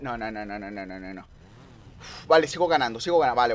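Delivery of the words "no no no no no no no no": 1 anxious murmur. Real, not acted. Fears losing, murmurs.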